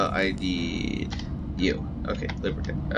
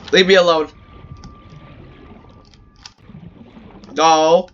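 panicked yelling; 6 semitones higher, 6 dB louder than usual. Real, not acted. Creature harassing him again.

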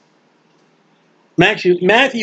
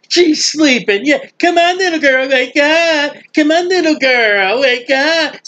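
high, jeering mockery of the mourners; mocking scoffer; 6 semitones higher than usual. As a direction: sneer at him like a scoffer laughing him to scorn. Mockers scorn Jesus at the house.

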